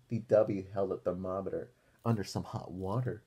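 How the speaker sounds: usual speaking voice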